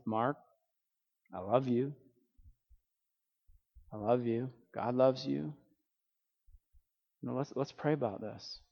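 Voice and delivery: soft, unhurried comfort with long pauses; imitating a dad gently consoling his son; tender fatherly reassurance. Father comforting his son who messed up, tender retold reassurance.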